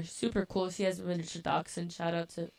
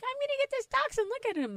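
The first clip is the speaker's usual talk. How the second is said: much higher, fast gushing voice; excited sister imitation; faster than usual. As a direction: gush over the puppy, high and quick. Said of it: "my sister's like".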